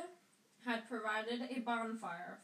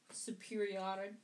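ordinary delivery, nothing marked

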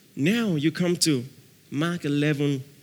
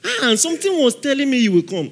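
mocking; 7 semitones higher, 5 dB louder, faster than usual. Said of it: "mocking believers".

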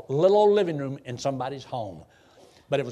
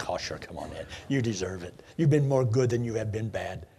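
imagined God. Retold or imitated. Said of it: "God will forgive me. Say".

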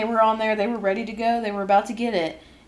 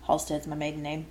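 ordinary delivery

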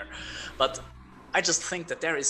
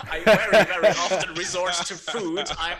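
louder and higher through laughter; laughing; 5 semitones higher, 5 dB louder than usual. Real, not acted. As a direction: laugh it out while confessing. Confessing stress eating, laughing.

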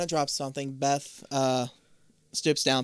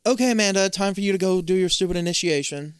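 higher, louder put-on voice; mimicked bossy kid; 5 semitones higher, 3 dB louder than usual. Beth sending Amanda to her initiation, bossy higher voice. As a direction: announce it pitched up and pushy.

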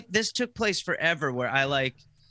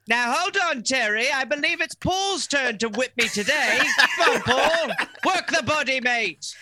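high, chummy Cockney-ish voice; high chummy English accent; cheery English accent; 9 semitones higher, 3 dB louder than usual. Pretend. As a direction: invite the beating cheerfully. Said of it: "continuing the British bit".

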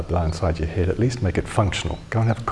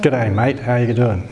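broad Aussie greeting, raised and loud; cheery Australian accent, pitched up; 4 semitones higher, 4 dB louder than usual. Pretend.

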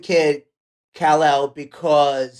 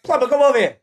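higher and louder, calling across the yard; mock calling a kid; 6 semitones higher, 4 dB louder, faster than usual. Father playfully calls his son Plumber over, mock shout.

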